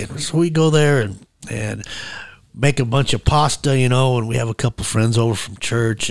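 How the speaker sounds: ordinary talk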